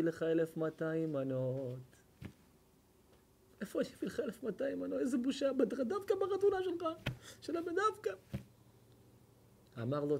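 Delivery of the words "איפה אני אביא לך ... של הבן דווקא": high, fast, frantic lament; panicked wail. Retold or imitated. Hall owner panicking to the rabbi, high frantic wail. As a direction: rush it out high like a man in a panic.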